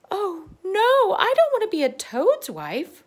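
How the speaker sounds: very high, loud distressed wail. Acted. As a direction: cry out in despair like a tiny girl. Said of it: "wailed Thumbelina".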